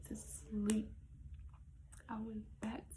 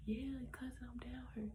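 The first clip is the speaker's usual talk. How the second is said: whispered answer; 8 dB quieter than usual. Retold or imitated. Cousin answering in the dark.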